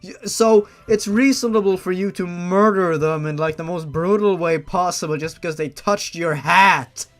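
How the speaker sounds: loud, sarcastic outrage; angry rant. Real, not acted. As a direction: yell it like the hat excuse is absurd. Ranting at the fanfic character.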